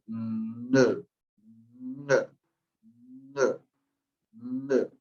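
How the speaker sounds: "N" hummed as a nasal sound, repeated; nasal demo. Shown as a demonstration. Teacher modelling the nasal NG for students. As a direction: hum the NG nasal onset. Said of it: "cómo yo lo pronuncio".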